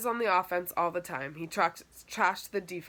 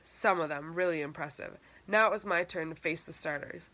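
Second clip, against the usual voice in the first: ordinary delivery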